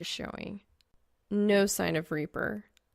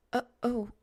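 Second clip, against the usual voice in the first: stuttering; 4 semitones higher than usual. Acted. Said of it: "she stutters".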